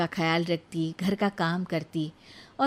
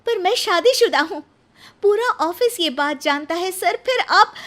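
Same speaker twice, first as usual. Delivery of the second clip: much higher, louder indignant protest; angry Nisha; 10 semitones higher, 7 dB louder than usual. Acted. Nisha protesting to her boss, indignant. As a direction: object sharply to the proposal. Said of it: "निशा को ये सुनकर गुस्सा आ जाता है".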